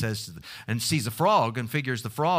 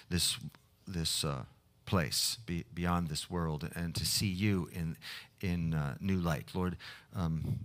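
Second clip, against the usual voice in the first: quiet, lower prayer voice; hushed pastoral prayer, sinking softer toward amen; 6 semitones lower, 6 dB quieter than usual. Acted. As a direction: pray softly and haltingly to God.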